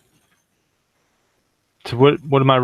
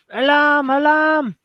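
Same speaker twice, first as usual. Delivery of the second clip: high-pitched alarm call; yelling out; slower than usual. Acted. Player voices his character raising the alarm, yelling out. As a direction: sound the alarm in a high, drawn cry. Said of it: "I think he yells out".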